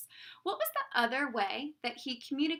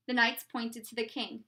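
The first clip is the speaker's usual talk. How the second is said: usual speaking voice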